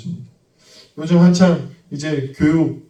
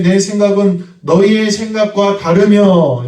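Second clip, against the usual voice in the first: slow, raised scripture reading; preacher solemnly reading Isaiah aloud; 7 semitones higher, 6 dB louder, slower than usual. Acted. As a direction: intone the verse with weight. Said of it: "여호와의 말씀에".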